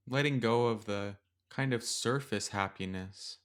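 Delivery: gentle metta meditation read; guided meditation. Acted. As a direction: ease the listener into stillness with long pauses.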